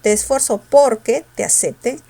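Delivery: "porque" weighted on "por"; stress on POR. Shown as a demonstration. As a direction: lean on the first syllable of "porque". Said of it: "señalando que ahí está la fuerza".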